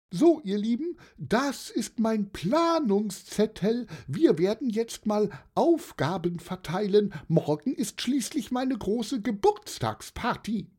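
high-pitched aunt taking charge; high, important announcement from the birthday aunt; jolly old aunt, organising. Acted. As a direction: address the group in a high auntie voice.

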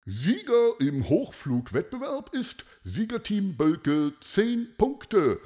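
high-pitched contest announcer; loudspeaker announcement. A narrator's reading. Announcer proclaims the winning team, announcer voice. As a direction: proclaim the winner to the crowd. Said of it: "hörte man die Lautsprecherdurchsage".

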